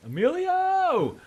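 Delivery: drawn-out, very high-pitched cry; mock calling out. Host cries out to Emilio over his skating, mock high wail. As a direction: wail the name out high and long.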